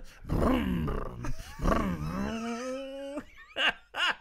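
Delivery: long high drawn-out laugh. Real, not acted. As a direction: let a long laugh roll out. Laughing after impression.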